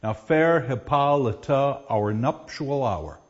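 line hammered into short-long beats, slow at the end; sing-song da-DUM reading that forces the meter; forced iambic rhythm. Shown as a demonstration. Teacher forces the opening line into iambic beat. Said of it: "We can force this line into that pattern".

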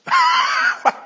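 high, loud burst of real laughter; a sudden shrieking laugh at his own telling. Real, not acted. Lecturer bursting into laughter at Sancho's puzzled reply, shrieking laugh.

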